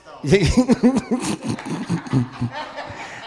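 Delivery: long high-pitched laugh; high, real belly laugh. Real, not acted. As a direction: burst out laughing, high and sustained. Hearty laugh during live show.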